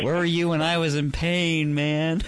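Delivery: high, loud whiny wail; whiny heartbroken guy. Retold or imitated. Imagined guys pleading.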